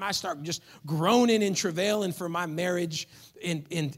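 normal talking voice

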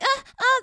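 hammed-up moaning; mock loud moan. Shown as a demonstration. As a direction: ham up an over-the-top moan. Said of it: "If you're just like".

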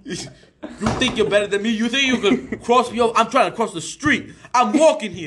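hammed-up irate Brooklyn accent; angry Brooklyn accent. Pedestrian confronting whoever blocks the street, angry Brooklyn accent. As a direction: pick a mock street fight in Brooklynese. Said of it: "You're just always angry at everybody".

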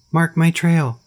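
high shriek. A narrator's reading. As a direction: shriek it as you fly away. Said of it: "The last words were shrieked".

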